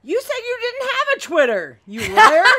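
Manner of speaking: high-pitched accusing outburst; indignant squeal at being fooled; playful outrage. Real, not acted. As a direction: call out a liar, high and rising loud. Mock accusation over secret Twitter.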